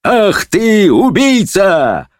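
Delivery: high, drawn-out horrified cry; shrieked indignant insult; screaming judge. A narrator's reading. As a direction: cry out the accusation in outrage. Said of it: "закричал судья на волшебника".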